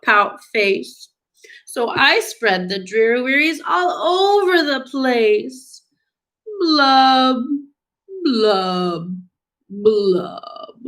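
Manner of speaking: stretched-out, sing-song storybook lament; gloomy pout-pout fish. Acted. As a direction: drag the opening, then sink through the blubs. Acts the fish's refrain.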